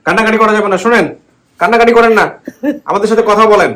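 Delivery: loud, high comforting; to someone hard of hearing. Real, not acted. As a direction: loudly soothe a weeping deaf woman. আনো কম শোনেন.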